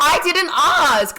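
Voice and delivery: slow, high and loud put-down; sassy retort. Retold or imitated. Telling off Melbourne people who rant, a slow sassy dismissal. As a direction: drag it out with sass.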